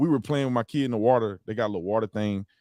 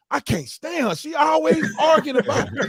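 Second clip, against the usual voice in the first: high, loud, laughing rant; mock yelling at wife; 3 dB louder, slower than usual. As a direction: blow off steam shouting at her, half laughing. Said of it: "I start yelling at my wife".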